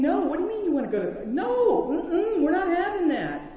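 high, loud, laughing mock protest; Peter's protest. Pastor voicing Peter refusing the cross, laughing mock protest. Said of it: "Peter's doing that. He's saying".

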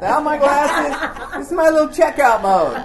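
high, loud punchline delivery; comic exclamation. Real, not acted. Preacher confessing his checkout trick, loud and high for laughs. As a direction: call it out over the laughter.

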